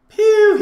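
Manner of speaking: piercing mock shot noise; high loud "pew". Player firing his bow, high "pew". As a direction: squeal out a shooting noise.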